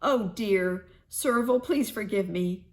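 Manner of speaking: Dog suddenly begs Serval's forgiveness, loud and high; blurted out. A narrator's reading. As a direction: blurt out the apology all at once. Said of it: "Then Dog blurted".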